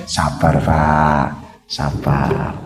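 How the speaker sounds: low, soft and slow, calming someone down; soothing calm. Acted. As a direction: talk an angry man down gently. Acting a calming friend. (menenangkan dengan lembut dan pelan)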